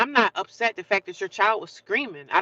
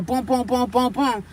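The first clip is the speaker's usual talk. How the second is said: booming knock sounds, pitched up; imitated door knocking; 5 semitones higher, slower than usual. Housemate acting out her banging on the door, booming imitation. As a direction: voice the pounding on a door. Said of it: "knocked on the door".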